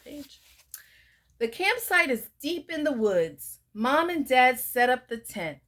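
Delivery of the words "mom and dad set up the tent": usual speaking voice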